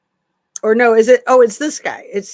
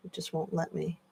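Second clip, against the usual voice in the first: usual speaking voice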